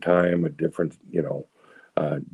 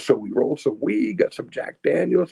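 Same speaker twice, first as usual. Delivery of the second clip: high-pitched storytelling stranger; excited stranger; 10 semitones higher than usual. Retold or imitated. Stranger confessing to the speaker after the meeting, high imitated voice. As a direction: play the other man, pitched up and eager. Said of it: "this is him, not me".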